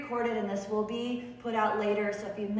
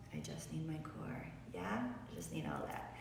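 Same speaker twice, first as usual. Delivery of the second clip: unvoiced breathy whisper, much softer; whispered inner voice; 6 semitones lower, 13 dB quieter than usual. Yoga teacher voicing a student's inner need, in a whisper. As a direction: breathe the words out as a private thought.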